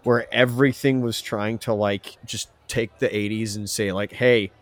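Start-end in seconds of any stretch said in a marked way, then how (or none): none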